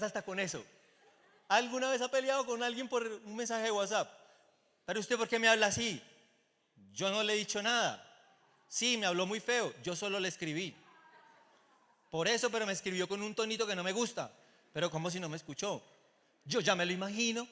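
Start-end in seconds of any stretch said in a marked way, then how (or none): 1.5-4.1 raised, loud question to the crowd. Real, not acted. Preacher asks the congregation loudly. 4.9-17.5 loud, high mock argument; acted quarrel. Acted WhatsApp quarrel between two.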